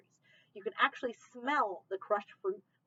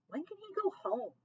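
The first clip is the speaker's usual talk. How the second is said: pleading question; 12 semitones higher than usual. Acted. Narrator pleads to grandma.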